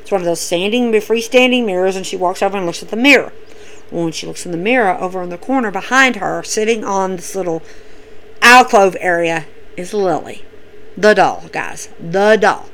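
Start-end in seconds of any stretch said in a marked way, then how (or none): 2.1-12.7 hushed. Real, not acted. Spooky reveal of the doll.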